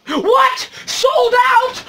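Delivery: high-pitched acted outrage; hammed-up incredulous squeal; shocked disbelief. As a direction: cry it out high, as if you can't believe it. Acts shock at finding pops sold out.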